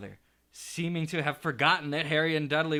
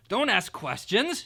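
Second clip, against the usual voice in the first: snapped; 8 semitones higher, 6 dB louder, slower than usual. A narrator's reading. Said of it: "snapped his uncle".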